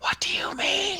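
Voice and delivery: put-on loud, high kid's whisper; stage whisper. Kid leader questioning the little girl, stage whisper. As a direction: whisper back like a confused child. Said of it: "It's like a stage whisper".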